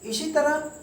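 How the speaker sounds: usual speaking voice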